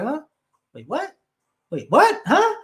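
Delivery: mock confusion, pitch shooting up at the end; puzzled double-take with sharply rising questions; baffled listener. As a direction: play someone completely lost by fast talk. Imitates baffled listener of fast patois.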